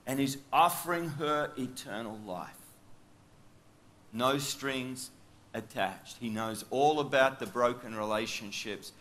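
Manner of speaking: usual speaking voice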